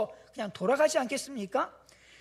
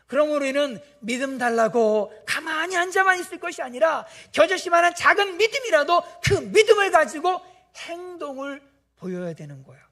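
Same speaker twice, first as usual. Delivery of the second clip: ordinary delivery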